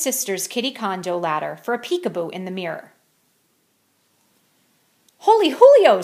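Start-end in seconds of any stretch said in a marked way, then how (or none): 5.2-6.0 high, loud excited squeal. Acted. Kitty boy spotting chihuahuas in the mirror, squealing high. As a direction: squeal it with delight. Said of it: "squealed the kitty boy".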